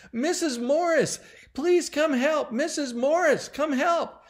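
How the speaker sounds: high, loud cry for help; panicked calling. A narrator's reading. Heather calling Susan's mom downstairs for help, panicked. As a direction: call downstairs in acted panic. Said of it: "her voice was so full of panic".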